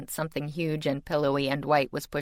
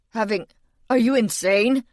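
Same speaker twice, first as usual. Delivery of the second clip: much louder, high panicked shriek; 6 semitones higher, 7 dB louder than usual. A narrator's reading. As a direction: shriek in disbelief. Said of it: "he practically shrieked".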